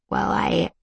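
breaking voice, trailing off; voice cracking. Acted. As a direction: let the voice crack mid-line. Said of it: "His voice cracks".

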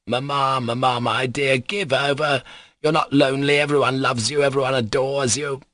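fussing over a weeping wife; soothing captain. Acted. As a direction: urgently reassure her, voice lifted. Captain comforting his sobbing wife.

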